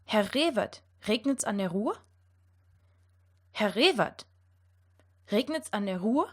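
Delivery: "Herr Rewert, regnet's an der Ruhr?" with uvular R throughout; German R. Shown as a demonstration. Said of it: "And now this sentence".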